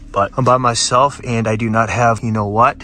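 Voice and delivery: ordinary delivery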